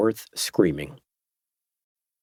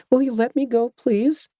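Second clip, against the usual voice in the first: much higher, frightened little plea; 14 semitones higher than usual. A narrator's reading. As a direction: plead in a thin high voice. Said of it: "in a high voice".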